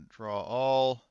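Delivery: ordinary delivery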